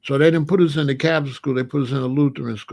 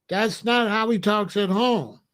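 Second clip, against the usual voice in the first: ordinary talk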